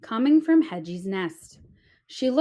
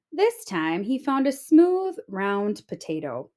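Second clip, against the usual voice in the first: usual speaking voice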